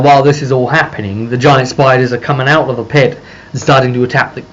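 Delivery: normal talking voice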